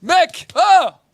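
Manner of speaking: high loud angel voice; angel calling. Acted. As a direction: rouse the sleeping prophet. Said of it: "Il dit".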